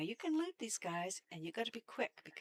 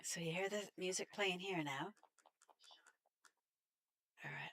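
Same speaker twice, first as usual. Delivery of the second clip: ordinary delivery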